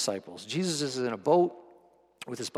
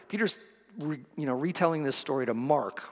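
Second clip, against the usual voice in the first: usual speaking voice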